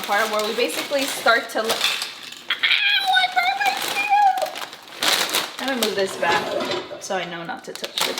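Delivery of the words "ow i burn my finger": high yelp of real pain; sharp high cry as her finger burns; sudden ow. Real, not acted. Burning her finger on the hot grill, a high yelp.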